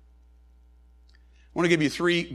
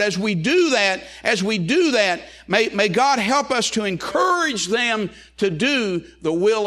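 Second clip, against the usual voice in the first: preaching at full force; 6 semitones higher than usual. Acted. Preacher urging the congregation to guide their children, full-force preaching. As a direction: preach it home, rising toward the close. Closing appeal to raise children.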